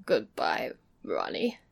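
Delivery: swallowing tears, voice dropping low; choking voice. A narrator's reading. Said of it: "in a queer choking voice".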